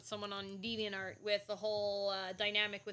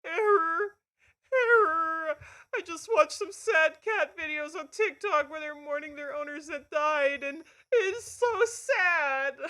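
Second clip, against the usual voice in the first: high, loud hammed-up crying voice; Ink; 8 semitones higher, 11 dB louder, slower than usual. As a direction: wail it out, drawing out the last words. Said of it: "let me do it in my Ink voice".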